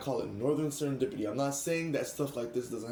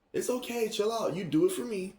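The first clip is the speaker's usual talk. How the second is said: lightly voiced friend, higher pitch; imitated reassuring friend; 10 semitones higher than usual. Friend telling her to chill out, higher imitated reassurance.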